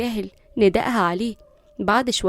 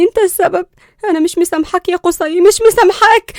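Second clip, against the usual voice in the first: high, loud, hurt accusation; a young woman shouting through hurt; angry blaming outburst; 8 semitones higher, 10 dB louder than usual. Acted. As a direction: throw the blame at him, near tears. Linda blames Qusay, crying.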